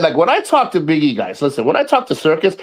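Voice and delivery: normal talking voice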